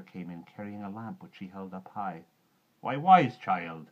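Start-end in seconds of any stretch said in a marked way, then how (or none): 2.8-3.9 put-on slow, loud, drawn-out Why, wise child; surprised motherly call. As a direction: stretch out wise child in loud gentle alarm. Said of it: "she said".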